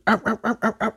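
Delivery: ow ow dog imitation; imitated dog barking. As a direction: bark like the dog.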